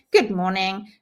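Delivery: ordinary delivery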